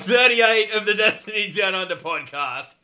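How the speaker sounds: loud, high-pitched podcast hype intro; high-energy show opener, voice pushed up and loud; announcer-style show opening. Acted. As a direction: belt out a big, drawn-out host's greeting. Podcast host intro call.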